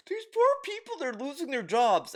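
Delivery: high-pitched mock pity; exaggerated pitying voice; mock sympathy. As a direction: plead it in a high, put-on wail. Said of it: "This dude is like".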